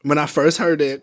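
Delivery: usual speaking voice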